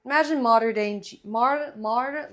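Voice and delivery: "modern" comes out as "mar-", r not landing; stumbling over the r in "modern"; says w for r. Real, not acted. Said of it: "I can't say my R's".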